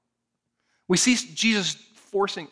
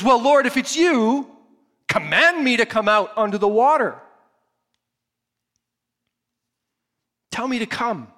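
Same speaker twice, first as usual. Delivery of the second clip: loud, high, urgent plea across the water; Peter calling out; 6 semitones higher, 6 dB louder than usual. Retold or imitated. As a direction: call out to Jesus, begging to be told to come. Peter pleads from the boat.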